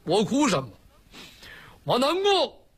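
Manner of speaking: high-pitched, hammed-up tearful lament; tearful wailing complaint. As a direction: wail it like an aggrieved son missing his mother. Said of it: "你哭什么呀？".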